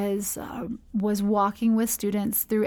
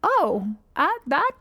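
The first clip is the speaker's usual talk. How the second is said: a higher, stunned stammer; taken aback; 4 semitones higher than usual. Retold or imitated. Mentor stunned by the girl's tiny price tag, higher stammer. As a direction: sound caught off guard by the answer. Speaker's surprised reaction retold.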